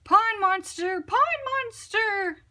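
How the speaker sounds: scared animals shouting warnings; panicked shout. A narrator's reading. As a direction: cry it out while running away.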